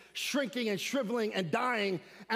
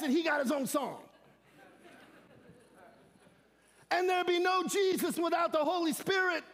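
preaching at full force; 6 semitones higher than usual. Acted. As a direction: lift the congregation with a shouted refrain. Preaching climax with music.

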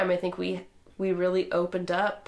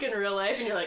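ordinary delivery

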